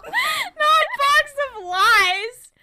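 "Not" shouted high, then "Box of Lies" drawn out slowly; stress on NOT. Real, not acted. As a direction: stretch "Not Box of Lies" loud, high and slow, laughing. Correcting friend while laughing.

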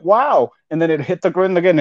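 usual speaking voice

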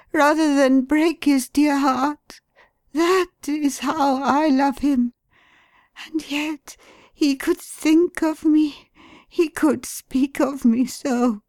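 high, pleading voice of a distraught wife; grieving wife, high and pleading; wife's anguished voice. A narrator's reading.

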